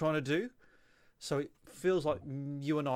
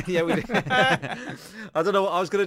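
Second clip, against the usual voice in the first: breaking into laughter mid-line; can't keep a straight face, voice higher and louder; laughing through it; 6 semitones higher, 6 dB louder than usual. Real, not acted. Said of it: "No, I like that".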